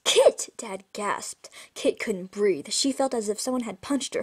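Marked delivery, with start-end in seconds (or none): 0.0-0.5 high, loud startled call of a name; startled cry. Acted. Dad startled to find his daughter there, high loud cry. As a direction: blurt the name in shock.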